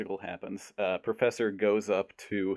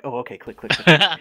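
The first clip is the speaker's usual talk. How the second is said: louder, higher clicking chant; mock sorority girls clicking; 5 semitones higher, 10 dB louder than usual. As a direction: ham up the eager clicking. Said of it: "And they're like".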